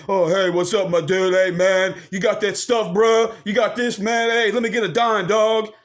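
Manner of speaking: put-on street dude voice, pushed out; mock a slang-heavy dude hassling someone; mocking slang talk. Dudes asking for a dime, mocked in slangy voice. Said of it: "when they don't talk like that".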